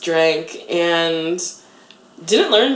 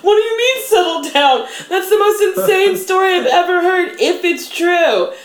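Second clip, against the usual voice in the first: laughing disbelief; 13 semitones higher, 3 dB louder than usual. Retold or imitated. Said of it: "I was just like".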